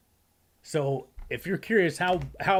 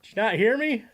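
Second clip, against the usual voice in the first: imitating Dell; 9 semitones higher, 4 dB louder than usual. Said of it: "Rod, seriously, right there".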